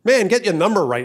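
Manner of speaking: bad-tempered, mounting annoyance; annoyed. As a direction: act out a short-tempered man on the phone. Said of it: "No, that's annoyance".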